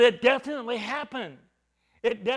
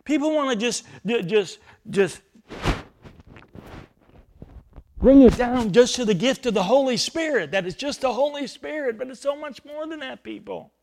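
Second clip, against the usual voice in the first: preaching emphasis; 3 semitones higher, 7 dB louder than usual. Real, not acted. Preacher rebuking a narrow view.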